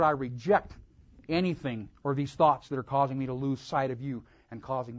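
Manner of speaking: loud, urgent prayer of repentance; crying out in prayer. Acted. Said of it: "to cry out".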